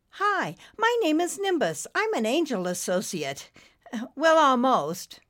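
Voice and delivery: high, eager introduction; chirpy cartoon newcomer; friendly little cloud. A narrator's reading. Cloud cheerfully introduces himself to snake.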